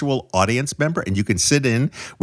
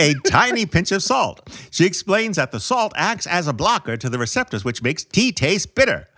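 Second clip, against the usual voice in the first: put-on high-pitched old-time newsman; 1940s news voice; 5 semitones higher than usual. Co-host explains the salt in tea, 1940s news voice. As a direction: report it like a forties movie reporter. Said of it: "like the nineteen forties news voice?".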